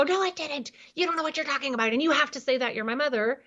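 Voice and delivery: lightly voiced teen arguing with her mother; upset teenager. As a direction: play the frustrated daughter snapping back. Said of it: "which is gonna make them go".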